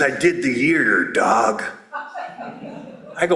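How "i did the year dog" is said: deep, low tough-guy voice; huge tough guy. Acted. Huge tattooed man confiding after the convention, low tough guy. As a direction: drop low like a big tattooed dude.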